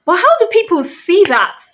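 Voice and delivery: high, loud, disbelieving cry; exasperated outburst. Real, not acted. Ranting to viewers about victim blaming, loud exasperated. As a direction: throw the question up in outrage.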